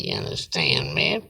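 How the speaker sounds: ordinary talk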